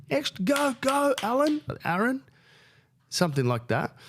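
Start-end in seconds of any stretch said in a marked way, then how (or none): 0.1-2.2 much higher cheering call; high, playful cheer from the crowd; mock cheering. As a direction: cheer him on from the audience. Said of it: "I was cheering".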